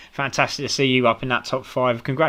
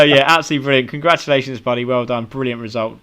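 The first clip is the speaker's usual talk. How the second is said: ordinary talk, nothing marked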